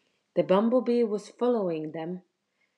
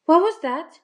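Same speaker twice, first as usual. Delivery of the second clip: high, loud alarmed question; scared girl asking her friend; startled fright; 10 semitones higher, 11 dB louder than usual. Acted. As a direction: blurt it out in a fright.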